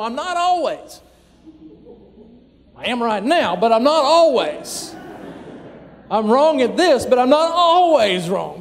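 a fired-up spouse defending their turf; defensive pushback. Acted. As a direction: push back hard, you're being accused. Fighting back against an accusation.

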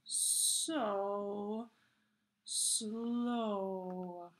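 "so... slow", each word spread out into one long vowel; drawn-out words. Shown as a demonstration. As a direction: stretch "so" and "slow" very long, with a pause between. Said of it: "it's spread out, so you're supposed to say it".

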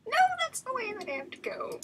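high-pitched wail sliding down; panicked protest. Real, not acted. Player blocked from the way out, panicked protest. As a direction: whine in alarm, starting very high. Player trapped in horror game.

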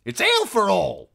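high, loud announcement; bossy innkeeper's wife. A narrator's reading. Innkeeper's wife settling drinks for all, high loud voice. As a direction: settle it loudly, pitched high.

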